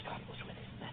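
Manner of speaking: whispered close to the congregation; hushed whisper. Acted. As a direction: breathe the words out reverently. Hushed ending of sermon climax.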